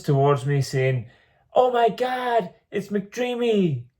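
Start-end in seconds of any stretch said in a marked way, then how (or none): 1.5-3.9 high, drawn-out, bad American accent; gushing "Oh my God" in a hammed-up American accent; terrible American accent. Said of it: "that was a terrible American accent".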